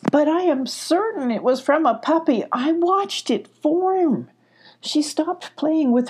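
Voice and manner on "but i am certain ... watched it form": higher-pitched earnest insistence; lightly acted, certain protest; insistent. A narrator's reading. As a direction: insist you really saw it, voice lifted. Puppy character insists on what she saw.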